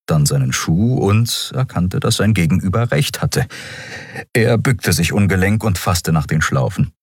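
ordinary delivery

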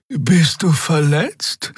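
high, slow concerned question; slow, high-pitched worried voice. A narrator's reading. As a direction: ask with concern, drawn out and high. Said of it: "fragte Xea besorgt".

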